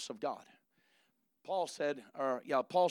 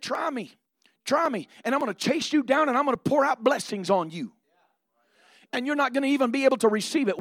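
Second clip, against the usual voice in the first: excited God's dare; 7 semitones higher, 7 dB louder than usual. Acted. Preacher voices God's dare.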